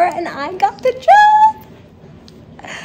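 high, loud and slow, bursting with joy; real excitement. Real, not acted. Announcing to viewers she got the job, thrilled. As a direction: let the good news burst out. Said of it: "I am so excited".

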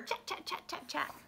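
soft, quick repeated chatter noises; hushed staccato chit-chatter; animal chattering. A narrator's reading. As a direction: imitate little animals chattering quietly. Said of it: "and they chatter".

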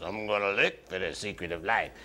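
low, soft character voice; Mister Hydro. Acted. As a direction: drop down low and quiet for a storybook character. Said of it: "But he talked like this".

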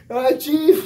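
very high, loud frightened wail; panicked cry. Retold or imitated. Scared recruit on the ledge to the chief: high panicked wail. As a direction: whimper out like you're terrified of heights.

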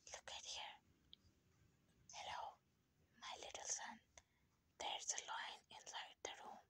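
hushed whisper to a little one. Acted. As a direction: whisper it like a secret. Playful scary story to baby.

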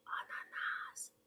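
slow voiceless whisper. Shown as a demonstration. Teacher shows children how to say the word "ананас", whispered. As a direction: whisper it, drawn out syllable by syllable. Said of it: "слово ананас пошепки".

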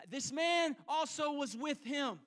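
single out Peter for the crowd to hear; accusing servant girl. Acted.

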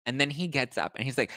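normal talking voice

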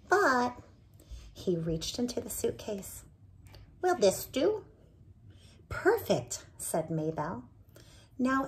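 put-on lively read-aloud for children; animated storyteller voicing each character; story narration. A narrator's reading. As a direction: tell it like a bedtime storybook. Reading picture book aloud.

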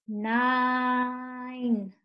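"Nine" drawn right out; slow. Shown as a demonstration. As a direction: hold the long I in "Nine" on a level note. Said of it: "Say it slow".

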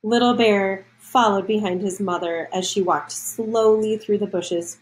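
ordinary delivery

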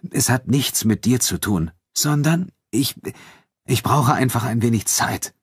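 hesitant, broken delivery with stretched words; halting. A narrator's reading. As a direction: stumble and pause before finishing. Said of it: "sagte er stockend".

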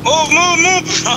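high, loud urgent yell; yelling move. Real, not acted. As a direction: shout at him to get moving.

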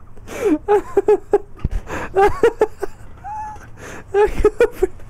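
high squealing laugh with sobbing breaths; real helpless cackle; crying laughter. Real, not acted. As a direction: collapse into tearful laughter.

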